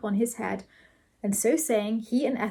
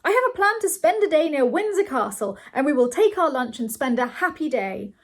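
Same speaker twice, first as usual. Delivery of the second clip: eager, louder announcement, starting high; excited cry; 5 semitones higher, 5 dB louder than usual. A narrator's reading. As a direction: propose an outing excitedly. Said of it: "cried Bernard".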